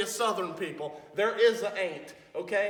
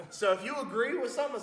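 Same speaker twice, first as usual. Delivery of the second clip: ordinary talk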